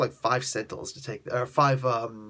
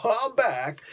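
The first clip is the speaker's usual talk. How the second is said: mock comeback cry; 10 semitones higher than usual. Retold villain announces his return.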